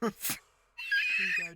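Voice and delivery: very high, loud, slow stretched cry; drawn-out call. Acted. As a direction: sing it out long as you pass it over. Hands over the smoke.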